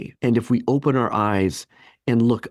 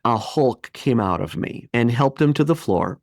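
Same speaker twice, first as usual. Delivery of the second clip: normal talking voice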